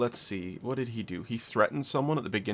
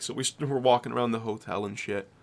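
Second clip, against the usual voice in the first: ordinary delivery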